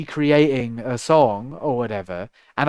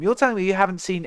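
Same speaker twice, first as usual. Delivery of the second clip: normal talking voice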